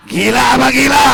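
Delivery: loud, hit GILA hard; shouted question. Shown as a demonstration. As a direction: hurl the question at the crowd. Preacher repeats question, then shouts. (pertanyaan diteriakkan keras)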